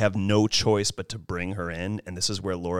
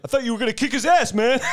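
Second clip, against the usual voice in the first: jock buddies; 14 semitones higher, 5 dB louder, faster than usual. Retold or imitated. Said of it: "his friends are like".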